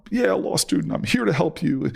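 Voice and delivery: high, eager do-gooder voice; mock his naive, cocky younger self; cocky law student. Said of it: "again, I'm this".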